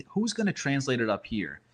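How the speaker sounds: ordinary delivery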